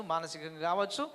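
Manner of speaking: normal talking voice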